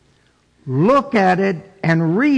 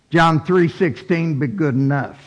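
normal talking voice

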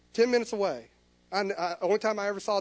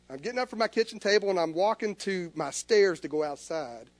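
ordinary talk

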